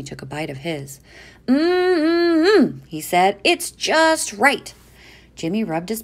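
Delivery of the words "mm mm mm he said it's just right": high happy humming, slow; savoring yum. A narrator's reading. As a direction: relish the taste like a kid. Savoring food.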